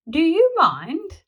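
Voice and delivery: loud, slow, indignant snap; be huffy and offended; tetchy. A narrator's reading. Triceratops disturbed on the loo, indignant.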